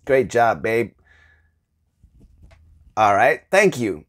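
husband giving lazy feedback after a cooked meal, low and offhand; bored perfunctory praise. Retold or imitated. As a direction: brush her off with a quick compliment. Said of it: "but like".

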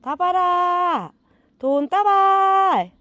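very high, slow, loud drawn-out call; long loud sing-song dog call. Real, not acted. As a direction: stretch the name out high and loud like a summons. Calling the dog loudly.

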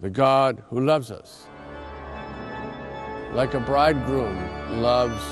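normal talking voice

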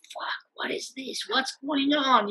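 breathy alarmed whisper, slowing down. Retold or imitated. Speaker recalling truck arriving at workshop, alarmed whisper. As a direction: hush a startled curse.

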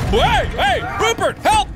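high, loud panicked call for help; panicked cry. Real, not acted. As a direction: yell for your friend in a panic. Attacked, calls for help.

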